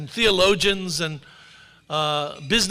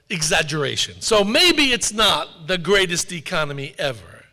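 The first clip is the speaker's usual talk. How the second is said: normal talking voice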